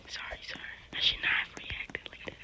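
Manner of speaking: very soft whispered apology; whispering. Real, not acted. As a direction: apologize in a quick, voiceless whisper. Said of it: "why am I whispering".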